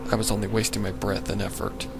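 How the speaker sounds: ordinary delivery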